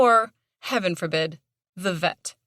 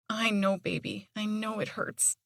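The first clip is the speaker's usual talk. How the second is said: tender; 3 semitones higher, 7 dB quieter than usual. A narrator's reading. Comforting hurt dog, tearful.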